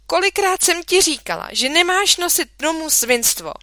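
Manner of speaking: high, loud exasperated scolding; mother scolding her son about filth; angry scolding. A narrator's reading. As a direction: scold him for bringing dirt home.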